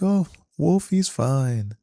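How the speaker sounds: slow, cold and unbothered; dead-eyed reply. Retold or imitated. Fake foster mom answering on the phone, cold and slow. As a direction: answer with icy calm. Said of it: "Dead-eyed, cold stare".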